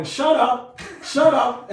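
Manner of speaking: loud, high parrot-like mimicry; talking bird. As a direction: squawk it like a bird copying its scolder. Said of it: "the bird starts going".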